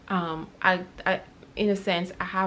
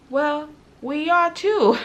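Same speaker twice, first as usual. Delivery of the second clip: higher, louder, slow laughing answer; laughing reply; 10 semitones higher, 11 dB louder, slower than usual. Retold or imitated. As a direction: laugh through it, delighted at the coincidence. Retelling their own reply, laughing.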